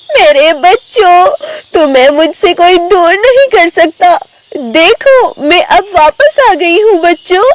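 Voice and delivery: high-pitched, emotional tigress; reunited mother tigress. Acted. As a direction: embrace the cubs with a high, put-on voice. (ऊँची आवाज़ में भावुक बाघिन माँ)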